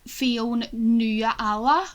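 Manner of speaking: Fionn Nuala split slowly into two names; slow mispronunciation. Shown as a demonstration. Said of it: "you'd be getting called F".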